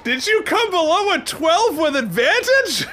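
incredulous. Real, not acted. Incredulous at low roll.

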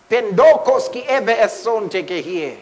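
a preacher speaking in tongues. Real, not acted. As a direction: pour out the tongue with fervour.

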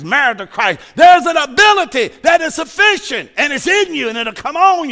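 loud, high pulpit climax; shouted preaching. Acted. Preacher roaring assurance to a struggling congregation, full shout. Peak of the sermon.

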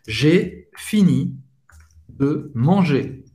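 slow, word-by-word French; teacher spells out each word slowly; slow example sentence. Shown as a demonstration. Teacher to Portuguese-speaking students, giving a slow example. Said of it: "a gente usa o voilà da mesma forma".

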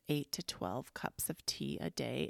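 ordinary talk, nothing marked

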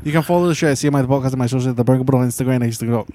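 very fast, words running together; rushed outro. Real, not acted. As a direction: rattle off the plug at top speed. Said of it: "What the fuck was that?".

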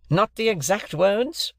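high, slow, incredulous repeat; bitter echo. A narrator's reading. Stranger repeats Wopsle's objection, bitter. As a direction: throw it back bitterly as a question. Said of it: "repeated the gentleman bitterly".

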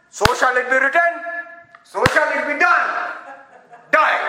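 loud, hammed-up royal decree ending in a curt death sentence; mock pharaoh decree. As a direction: proclaim it like a movie pharaoh. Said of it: "We think of God as".

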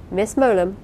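plain reading voice

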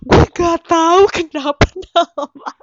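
laughing. Real, not acted. Laughing at own jerawat story.

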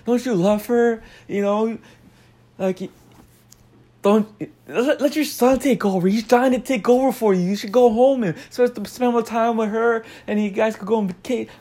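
high-pitched mock marriage counselling; Gordon Ramsay. Chef urging a husband to care for his wife, imitated high-pitched pleading. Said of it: "tell the husband".

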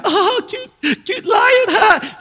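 high, loud mock cooing; exaggerated gushing over a cute child; laughing onlookers. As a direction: coo loudly like an amused crowd. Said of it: "Everyone's laughing".

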